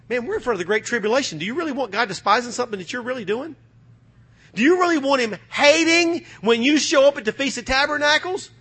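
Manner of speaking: usual speaking voice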